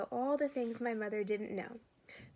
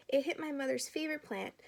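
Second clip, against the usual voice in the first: plain reading voice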